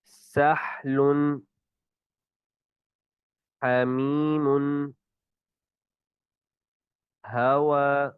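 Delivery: each haa breathed out freely; correct haa. Shown as a demonstration. Teacher models the haa word list. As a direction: recite the haa words slowly and openly. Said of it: "اسی طرح یہ ہا کی بھی ادائیگی".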